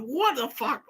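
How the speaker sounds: high-pitched, incredulous outburst; retold yelp of disbelief; bewildered exasperation. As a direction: act out being utterly baffled, pitch way up. Acting own bewildered reaction.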